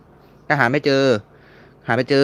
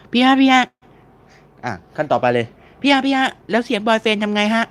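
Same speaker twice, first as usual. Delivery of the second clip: eager viewer; 10 semitones higher than usual. Retold or imitated. Acted viewer asking next question.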